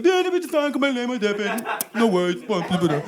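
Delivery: put-on deaf man's voice; mock deaf voice. Faking a deaf voice to guard.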